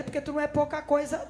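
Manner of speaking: ordinary delivery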